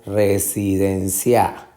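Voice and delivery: slow residencia landing on CIA; misplaced final stress. Shown as a demonstration. Teacher repeats options, residen-CIA. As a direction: lengthen CIA at the end of residencia. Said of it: "den es la sílaba".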